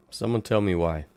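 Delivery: ordinary talk, nothing marked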